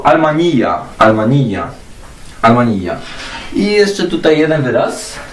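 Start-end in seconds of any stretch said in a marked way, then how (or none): 0.0-2.9 almania with a long final ija; Arabic pronunciation. Shown as a demonstration. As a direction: repeat almania clearly for learners. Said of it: "pozycja końcowa tego właśnie dźwięku". (arabska wymowa almania)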